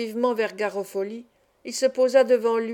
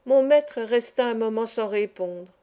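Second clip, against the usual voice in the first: ordinary delivery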